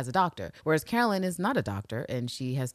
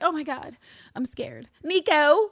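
high, squeaky, hammed-up fright; mock scared; 10 semitones higher, 4 dB louder, slower than usual. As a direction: whimper it like a damsel. [name] scared, calling Nico.

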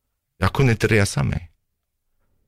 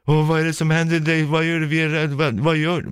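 much higher, urgent voice imitating a scared wife; frightened wife, retold; 10 semitones higher than usual. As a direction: run the worried questions together, high-pitched. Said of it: "jag hör ett annat vuxenljud". (härmad rädd fru, hög röst)

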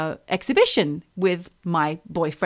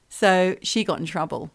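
usual speaking voice

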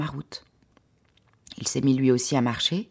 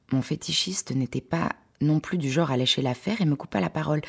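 ordinary delivery

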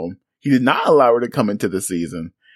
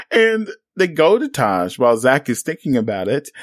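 normal talking voice

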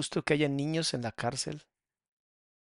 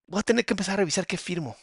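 normal talking voice

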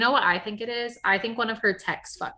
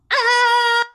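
high delighted shriek; delighted squeal; 11 dB louder than usual. Retold or imitated. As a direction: squeal with joy.

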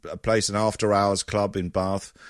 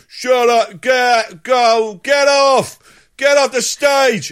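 loud, high mock heckler yell; heckler shouting; 12 semitones higher, 8 dB louder, much slower than usual. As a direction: bellow at the comic like a rival stag. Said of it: "blokes are like sort of stags".

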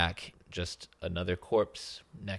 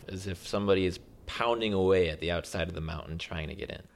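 ordinary talk, nothing marked

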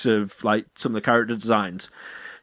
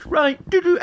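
mock crew. Crew responding to captain.

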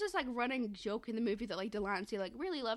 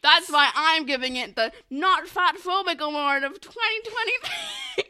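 loud shout rising higher into laughter; excited proclamation; 5 semitones higher, 11 dB louder than usual. Real, not acted. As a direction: proclaim the award at full volume. Shouting a mock award.

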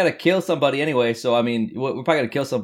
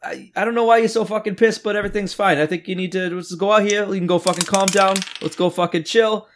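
higher-pitched coaxing talk; placating; 8 semitones higher than usual. Acted. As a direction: talk an angry guy down and ease him outside. Player acts calming angry man.

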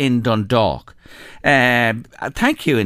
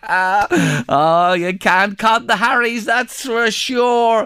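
laughing; 6 semitones higher, much slower than usual. Real, not acted. Host laughs at Hare Krishna story.

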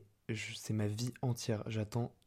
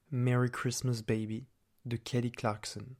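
put-on English accent. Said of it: "je prends un accent anglais". (avec un accent anglais)

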